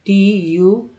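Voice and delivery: T U called out high and loud, letter by letter; spelling out letters. Shown as a demonstration. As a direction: announce the letters T U clearly to the class. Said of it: "यहाँ पर देखिए". (अक्षर T U ज़ोर से बोलकर)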